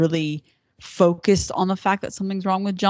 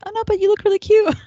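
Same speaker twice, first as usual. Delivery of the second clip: very high, loud, quick gush; gushing reassurance; 7 dB louder, faster than usual. Retold or imitated. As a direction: mimic someone cooing a compliment, pitched way up. Acting out Sarah's gushing reply.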